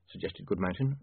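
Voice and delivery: ordinary delivery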